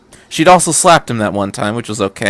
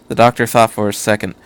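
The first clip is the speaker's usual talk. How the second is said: ordinary delivery, nothing marked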